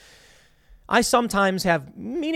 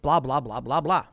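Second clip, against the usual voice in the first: lower, bored string of blahs; tuned-out filler, pitched down; dismissive skimming; 5 semitones lower than usual. Real, not acted. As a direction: drop low and brush off the rest of the text. Skips rest of article text.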